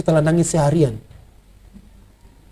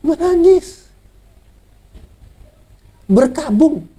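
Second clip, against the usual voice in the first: ordinary delivery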